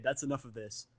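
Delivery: plain reading voice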